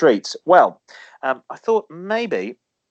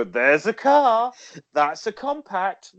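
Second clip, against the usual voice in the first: mock salesman; 7 semitones higher, slower than usual. Mock announcing a chopped-off car.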